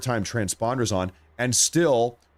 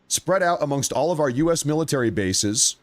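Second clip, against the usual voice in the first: higher, deliberate read-out of a statement; reading aloud; 4 semitones higher than usual. Real, not acted. Streamer reads viewers his post on Iran, reading aloud. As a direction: read it like a prepared statement, pressing each point.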